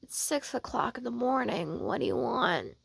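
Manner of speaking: soft, drowsy complaint; sleepy murmur. Acted. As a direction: murmur it half asleep. Sleepy character woken too early.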